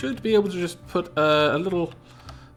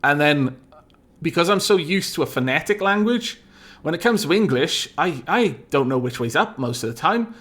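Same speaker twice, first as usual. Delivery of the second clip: ordinary talk, nothing marked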